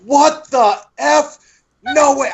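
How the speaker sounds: high, loud shocked outburst; shocked disbelief. Retold or imitated. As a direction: blurt it out in stunned disbelief. Retold shock reading Dumbledore's death.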